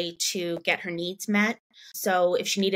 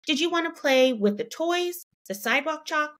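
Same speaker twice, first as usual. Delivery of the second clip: coaxing question; 7 semitones higher than usual. Retold or imitated. Mom offering choices.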